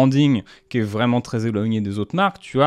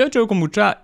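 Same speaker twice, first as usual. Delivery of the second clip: a higher, lightly mocked sign-off; imitating Squeezie; 9 semitones higher than usual. Mocking how the youtuber drops the drink at the end, higher light imitation. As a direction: toss off the brand name lazily, pitched up. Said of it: "il dit".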